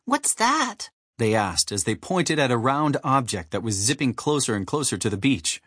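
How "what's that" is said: high little hatchling voice; high, curious baby bird. A narrator's reading. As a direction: ask in a tiny, high-pitched voice. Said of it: "A nearby hatchling spotted something".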